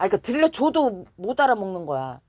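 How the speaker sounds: ordinary delivery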